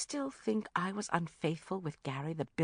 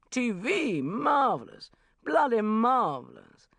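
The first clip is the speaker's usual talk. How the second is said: loud, raised, blustering approval; hearty bellow; 7 semitones higher, 7 dB louder, slower than usual. A narrator's reading. Banker congratulating Bridget on her TV job, bellowing. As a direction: boom out your approval, drawing out the last words.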